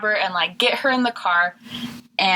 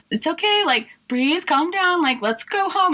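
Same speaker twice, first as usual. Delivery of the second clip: higher, coaxing calm-down voice; soothing; 8 semitones higher than usual. Retold or imitated. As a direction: coax a screaming child gently. Soothing screaming child.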